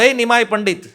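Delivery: loud, high call across a crowd. Retold or imitated. Brahmins calling out to Nimai. (ઊંચા અવાજે બૂમ)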